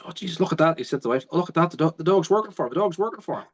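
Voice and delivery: high, astonished exclamations; amazed farmer. Retold or imitated. Farmer telling his wife the dog works for the trainer, amazed. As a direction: marvel at what you're seeing.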